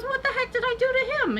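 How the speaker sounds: very high, incredulous protest; mock indignant disbelief. Joke's victim protests to doctor.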